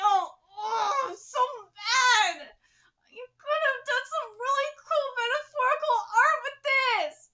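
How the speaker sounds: high, loud frustrated cry; exasperated rant. Real, not acted. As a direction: complain it with full force. Ranting at the author.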